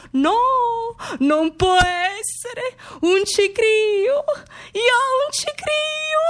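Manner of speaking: molto più acuta e lenta, sul punto di ridere; incredulità ridendo. Retold or imitated. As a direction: non ci credo, e scappa da ridere. Pensiero incredulo mentre ride. (pensiero incredulo tra le risate, voce altissima)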